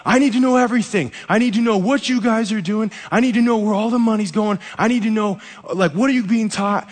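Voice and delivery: high, loud bad-cop grilling; hammed-up bad cop shining a light; mock interrogation. Fake bad-cop interrogation joke.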